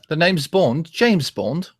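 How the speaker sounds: British spy impression; British accent. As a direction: introduce yourself in mock-British Bond style. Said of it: "A good British accent?".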